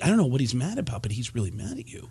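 quick low murmur fading to a whisper; hushed warning. Retold or imitated. People quietly warning Baldwin about Pacino. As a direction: warn him quietly, almost under the breath. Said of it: "he said that people would say to him".

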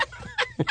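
short squeaky laugh; a sudden little giggle; quick high laugh. Real, not acted. Laughs mid-sentence.

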